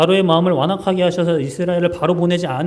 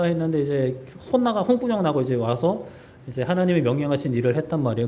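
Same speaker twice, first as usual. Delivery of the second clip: ordinary talk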